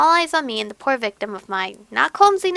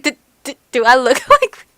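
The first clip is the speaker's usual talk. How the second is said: giggling through a mocking quote; laughing imitation; 10 dB louder than usual. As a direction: repeat his line while cracking up. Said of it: "I like how it's like".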